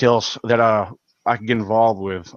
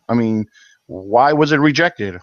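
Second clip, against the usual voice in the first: usual speaking voice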